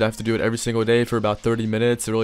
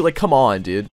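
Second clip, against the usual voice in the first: usual speaking voice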